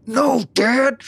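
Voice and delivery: imitating a rough, tearful "No, Dad"; groggy. Detective recalling Victoria's voice on the call, mock choked-up. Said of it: "It's a little groggly".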